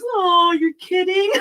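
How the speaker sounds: high-pitched mock dismay, breaking into laughter; talking dog impression. Dog told the cat got the treat, high mock dismay. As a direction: play the let-down dog, pitched right up. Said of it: "That was such a good impression".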